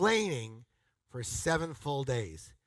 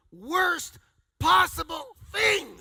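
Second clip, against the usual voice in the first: slow, much higher and louder; emphatic shout; 14 semitones higher, 8 dB louder, much slower than usual. Real, not acted. Speaker warning the crowd, slow emphatic shout. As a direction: punch it out at full force.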